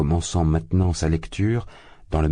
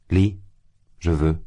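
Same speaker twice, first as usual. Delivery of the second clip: insisting. A narrator's reading. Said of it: "insistait-il".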